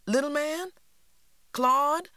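put-on high, loud call to the boys; child calling her brothers over. A narrator's reading. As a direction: shout for them across the yard. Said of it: "I cried".

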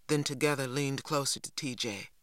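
plain reading voice

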